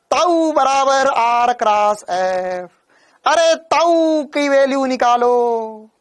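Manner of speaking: slow, loud shout of ताऊ; shouting taau. Retold or imitated. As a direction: yell the formula out slowly, saying ताऊ like uncle. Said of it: "जोर-जोर से चिल्लाता हूँ".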